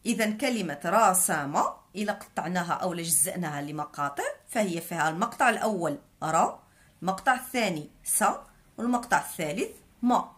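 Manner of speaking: ordinary delivery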